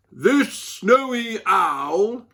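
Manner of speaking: slow, hushed documentary voice; nature presenter. A narrator's reading. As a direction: present it like a wildlife show. TV presenter narrating to camera.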